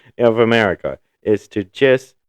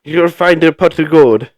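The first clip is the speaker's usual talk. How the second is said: higher, louder leprechaun voice; sing-song it like a leprechaun; hammed-up Irish leprechaun; 8 semitones higher, 8 dB louder than usual. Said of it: "Sounds like a leprechaun".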